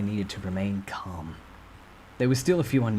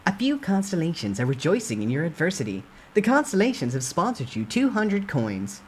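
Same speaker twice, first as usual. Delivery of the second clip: brisk, bright system message read; system announcement; 7 semitones higher, 3 dB louder than usual. Acted. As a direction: announce it like a game notice.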